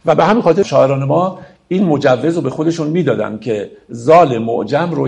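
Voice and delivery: ordinary delivery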